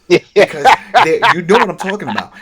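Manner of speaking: high, loud and fast; exasperated shout. Real, not acted. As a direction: blurt it out in frustration. Venting loudly at show.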